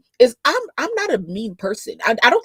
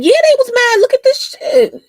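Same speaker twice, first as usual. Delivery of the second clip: gleeful squeal; 11 dB louder than usual. Real, not acted. Gloating over angry commenters.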